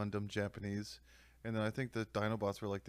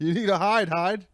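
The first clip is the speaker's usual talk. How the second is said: higher, louder urgent voice; mock warning; 9 semitones higher, 14 dB louder than usual. Friend punning on Hyde, mock urgent. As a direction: warn him in a hammy panic.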